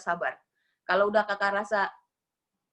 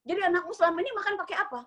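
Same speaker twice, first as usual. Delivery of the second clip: fast scolding question; 8 semitones higher, much faster than usual. Retold or imitated. Scolding the maid.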